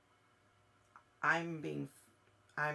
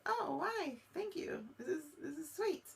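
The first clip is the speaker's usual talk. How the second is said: much higher, gushing thank-you voice; mock surprised delight; 13 semitones higher than usual. Medium imitating the mother getting a rose, high gushing. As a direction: play the flattered, touched receiver of a rose. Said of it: "And you're like".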